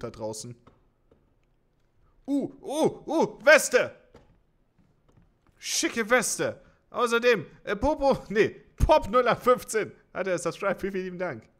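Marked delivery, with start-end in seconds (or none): 2.3-3.9 high, loud excited squeal. Real, not acted. Excited at finding a vest. 5.6-11.5 high, loud hyped voice; loud and high, buzzing with excitement; excited. Real, not acted. Hyped thanks for a subscriber.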